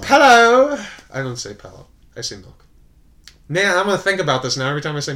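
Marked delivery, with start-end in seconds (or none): 0.0-0.8 loud, high-pitched mocking blurt; mock shout. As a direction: belt it out high in mock imitation. Mocking the alternative pronunciation loudly.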